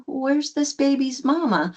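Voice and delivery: high-pitched retold wondering; concerned searching question. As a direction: ask it high, looking around. Looking round for crying boy's mother.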